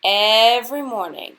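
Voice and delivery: usual speaking voice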